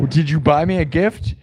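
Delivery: usual speaking voice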